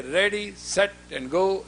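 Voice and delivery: slow, spaced ready, set, and go; drawn-out count. Shown as a demonstration. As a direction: count it off slowly with a pause before go. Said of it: "I'll say".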